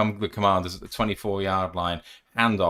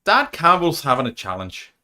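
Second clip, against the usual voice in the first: put-on Irish accent; 5 semitones higher, 4 dB louder than usual. Play call in put-on accent.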